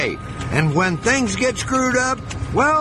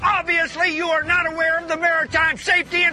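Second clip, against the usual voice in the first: shouting; 8 semitones higher than usual. Real, not acted. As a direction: yell it out in disbelief. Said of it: "Are you kidding me".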